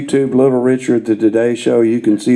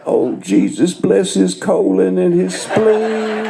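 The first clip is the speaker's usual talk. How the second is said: slow, hammed-up preacher prayer; drawn-out mock blessing with a laugh; 8 semitones higher, slower than usual. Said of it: "he's sitting there".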